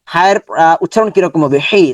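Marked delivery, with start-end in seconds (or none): 1.7-1.9 forceful h in হে; strong হে. Shown as a demonstration. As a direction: say হে with a strong throaty h. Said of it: "strong sound হবে".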